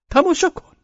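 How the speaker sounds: insistent call. Acted. Repeated call, much higher.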